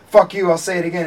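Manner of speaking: high, loud retold defiance; imitated defendant's outburst; mock screaming. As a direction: shout back at the judge, hammed-up. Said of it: "screaming at each other".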